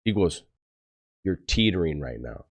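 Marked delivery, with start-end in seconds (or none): 1.2-2.5 low, soft voice of authority; lightly voiced captain, quietly warning; imitated captain's warning.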